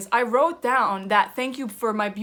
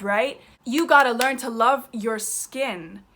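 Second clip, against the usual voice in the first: ordinary talk, nothing marked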